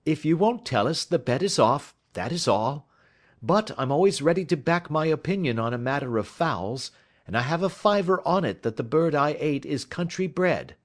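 Holmes, careless. A narrator's reading. Holmes offering a bet on fowls, careless.

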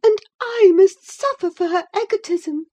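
high, loud tearful protest; sobbing complaint. Acted. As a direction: wail the grievance as if sobbing. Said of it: "she sobbed".

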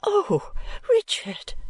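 high, pained protest; remonstrating. A narrator's reading. Esther reproaching Richard for his suspicions, high-pitched remonstrance. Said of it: "I remonstrated".

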